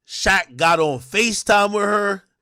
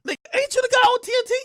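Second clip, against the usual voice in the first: much higher, fast disbelieving squeal; incredulous squeak; much faster than usual. Retold or imitated. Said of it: "The whole time I'm like".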